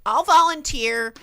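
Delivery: very high, loud, eager mock squeal; eager girl volunteering. Girl volunteering at the party to fit in, mock eager. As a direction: chirp it like a girl desperate to fit in. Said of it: "this girl who's trying to, like, fit in is like".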